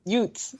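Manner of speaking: "Utes" for youths, with a t; movie mispronunciation. Retold or imitated. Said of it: "it comes out youths".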